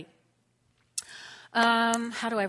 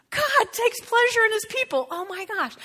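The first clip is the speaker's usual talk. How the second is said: excited; 11 semitones higher, 4 dB louder than usual. Acted. Excited restatement of scripture.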